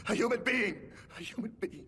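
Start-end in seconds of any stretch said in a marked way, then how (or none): 1.2-1.9 low, soft, drained repetition; spent accusation. Acted. Commoner accuses weeping noble, spent and quiet. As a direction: let the fury drain into a low murmur.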